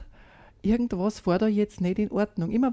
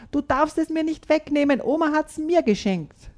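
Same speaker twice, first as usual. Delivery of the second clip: put-on boy shouting at his sister over his toy, higher; 7 semitones higher than usual. A narrator's reading. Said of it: "schreit Tom".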